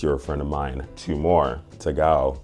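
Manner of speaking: normal talking voice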